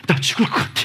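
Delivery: mock whisper of panic; whispered fear. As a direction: breathe it like you're scared stiff. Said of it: "이러지".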